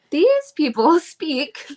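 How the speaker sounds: slow, laughing retake of "These people" without the Southern drawl; laughing, deliberate careful retake; laughing correction. Shown as a demonstration. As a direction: redo it carefully while laughing at herself. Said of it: "not in a Southern drawl".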